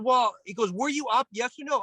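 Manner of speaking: high, pushy mock-coach voice; interrogating coach. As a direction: demand a yes or no like a drill sergeant. TK interrogating, retold.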